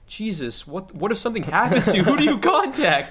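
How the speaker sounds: high, loud disbelieving questions; baffled, rising to a squeak; incredulous. Retold or imitated. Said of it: "in my head, I'm wondering".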